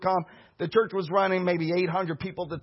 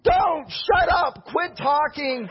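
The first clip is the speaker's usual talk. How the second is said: a slow, pleading mock shout; slow half-shouted pleading inside your own head; mock-frantic shout; panicked inner yell; 6 semitones higher, much slower than usual. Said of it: "in my mind, I'm thinking".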